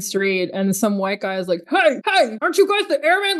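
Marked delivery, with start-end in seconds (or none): 1.7-3.4 friendly yell. Retold or imitated. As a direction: call out to the airmen, raised and eager. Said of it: "he's just yelling".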